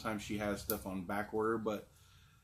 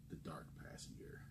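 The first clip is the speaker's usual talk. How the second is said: whisper; 18 dB quieter than usual. Acted. Ominous aside after reading label.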